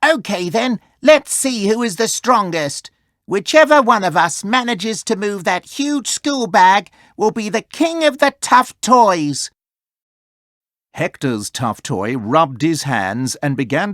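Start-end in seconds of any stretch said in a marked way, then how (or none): 0.0-9.5 higher, louder challenging toy voice; toy's challenge. A narrator's reading. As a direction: dare them to a contest. Ellie issues a challenge.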